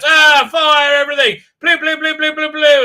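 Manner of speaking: high shouted command into silly laser noises; mock battle order. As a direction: yell like a hammed-up captain. Acting Tucker ordering fire, laser noises.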